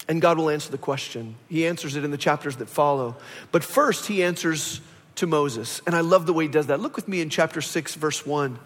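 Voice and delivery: ordinary talk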